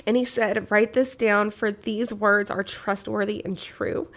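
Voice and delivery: solemn, measured Bible reading aloud; scripture reading. Acted. Reads Revelation aloud.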